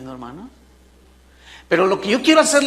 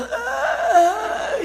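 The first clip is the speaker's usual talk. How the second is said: lion roar; 14 semitones higher than usual. Acted. Lion's roar imitated.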